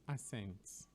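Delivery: "accent" without the K, as "assent"; common mispronunciation. Shown as a demonstration. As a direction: say "accent" as a-SENT. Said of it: "People normally pronounce it as".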